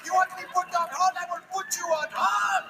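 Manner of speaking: put-on a call-center agent scolds the caller in an Indian accent. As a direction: threaten to put her on hold. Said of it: "because I'm Indian".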